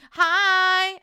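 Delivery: loud high hi; bright loud welcome; excited greeting. Real, not acted. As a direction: call out hi with delight. Greeting a newcomer.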